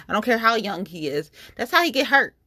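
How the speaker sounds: ordinary talk, nothing marked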